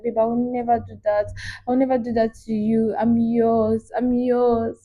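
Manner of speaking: soft, tender lover's voice; sweet and loving. Retold or imitated. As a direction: melt him with soft promises. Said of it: "Very sweet and loving".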